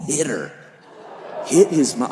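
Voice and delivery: usual speaking voice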